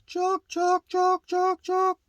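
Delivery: very high, quick-repeated "chalk" squawks; mimicked box call. Shown as a demonstration. Teacher likening the yelp to a box call, falsetto call mimicry. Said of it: "a box call".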